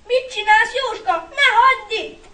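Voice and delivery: put-on high, loud childlike plea; upset little boy wailing; sobbing outburst. A narrator's reading. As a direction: cry out to your big brother in distress. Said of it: "zokogott fel a kicsi".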